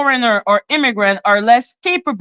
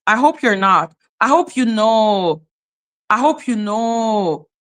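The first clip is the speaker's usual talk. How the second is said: normal talking voice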